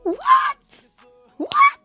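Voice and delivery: very high rising squeaks of disbelief; gasping high what, what; shocked what. Real, not acted. Disbelief at the chapter reveal.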